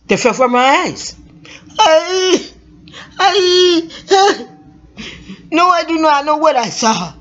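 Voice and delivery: high, tearful voice breaking into laugh-sobs; crying. Acted. Frightened woman telling her sister what she saw, crying. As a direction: stammer through tears and nervous laughter. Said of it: "Mabel Cthulhu cried".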